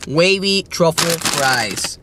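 "Wavy truffle fries" said very slowly, each word drawn out; careful naming. Shown as a demonstration. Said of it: "I don't know if I said wavy".

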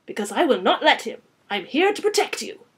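put-on loud, firm French-accented vow; rich French accent. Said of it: "with a rich French accent".

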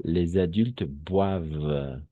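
boivent said slowly as "bwav", silent ending; slow model pronunciation. Shown as a demonstration. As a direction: spell out boivent slowly, ENT unsounded. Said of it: "est muet, es mudo".

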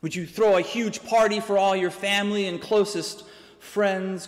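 ordinary talk

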